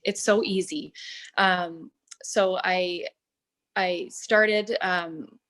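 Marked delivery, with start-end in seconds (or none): none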